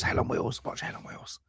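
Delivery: hushed whisper, fading away; whispered plug. Shown as a demonstration. Host whispering a show recommendation to the co-host, fading whisper. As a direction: whisper the show title like a secret.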